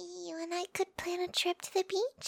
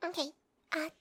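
ordinary delivery

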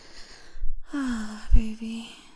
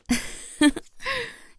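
giggle; 4 dB louder than usual. Acted. Delighted at being called mommy.

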